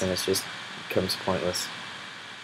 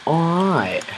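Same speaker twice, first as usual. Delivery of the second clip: high, drawn-out surfer-dude "All right"; surfer-accent "All right" to amuse friends; 8 semitones higher, 3 dB louder than usual. Retold or imitated. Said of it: "can you do the surfer accent".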